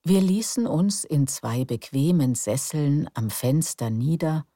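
calm, unhurried storyteller; bedtime story narration. A narrator's reading. (ruhige Gutenachtgeschichte)

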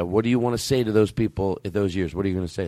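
normal talking voice

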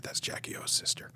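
hushed secret whisper. Retold or imitated. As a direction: lean in and whisper it confidentially. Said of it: "Graydon whispered".

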